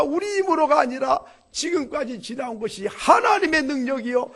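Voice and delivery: high, loud rallying cry; shouted plea. Acted. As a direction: cry out to win over the crowd. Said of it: "아무리 두 사람이 소리쳐도".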